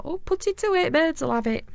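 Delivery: scolding parent. Retold or imitated. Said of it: "they'd be like".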